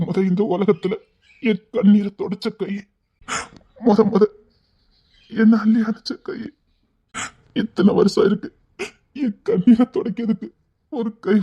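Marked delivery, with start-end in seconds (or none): none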